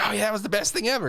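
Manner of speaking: high, fast mock enthusiasm; hammed-up, rushing gush; open gushing. What a depressed boy won't openly say, gushing. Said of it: "don't wanna just openly open".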